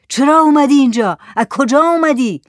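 high, loud challenge to a stranger; hollering. Acted. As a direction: holler the questions like an interrogation. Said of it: "زاهد هوار کشید". (هوار کشیدن)

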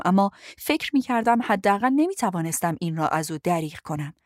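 ordinary delivery, nothing marked

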